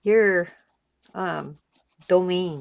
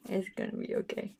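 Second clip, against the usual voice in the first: soft reassurance; 11 dB quieter, faster than usual. Real, not acted.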